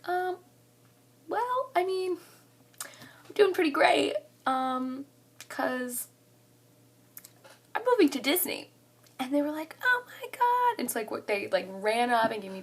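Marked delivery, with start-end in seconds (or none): 0.1-8.7 high, drawn-out teasing build-up; playfully coy, re-enacted; retold coy reveal. 9.8-10.8 very high mock squeal; retold delighted shriek; imitated excited squeal. Friends' retold joyful reaction.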